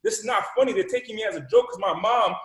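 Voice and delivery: high-pitched indignant complaint; exasperated protest. Retold or imitated. Muted student venting after group call, exasperated protest. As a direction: protest hotly at being made a joke.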